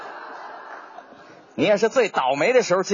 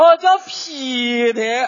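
imitating Sichuan; 4 semitones higher, 4 dB louder, much slower than usual. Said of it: "这四川话好听啊".

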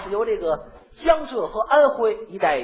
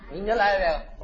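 ordinary delivery

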